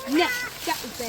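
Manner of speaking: loud stern scolding. Real, not acted. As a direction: tell the dog off sharply. Scolding dog.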